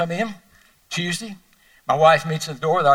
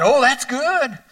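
second lady; 7 semitones higher, 4 dB louder, slower than usual. Acted. Joke dialogue, second lady replies.

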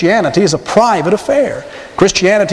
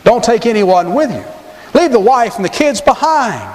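higher, slow, scheming condition; sly Pharaoh; 6 semitones higher than usual. Retold or imitated. Preacher acting Pharaoh setting his trap for Moses, higher. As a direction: slip in the catch with a sly higher voice. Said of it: "But now".

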